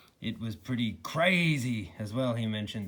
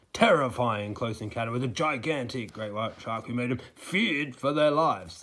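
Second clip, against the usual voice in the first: punching every fear word, building louder; emphasized fear words; 4 dB louder than usual. Shown as a demonstration. Mocking the news anchor, fear words stressed. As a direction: hit each scary word hard like a news promo. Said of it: "every time you go".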